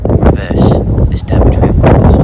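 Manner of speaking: loud, rousing speech to the nation; rally them like a leader; impassioned speech. Acted.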